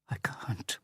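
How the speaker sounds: breathy, shaky whisper; trembling whisper. A narrator's reading. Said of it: "whispered in a trembling voice".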